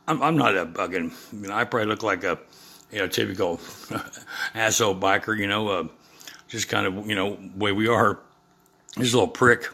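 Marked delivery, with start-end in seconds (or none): none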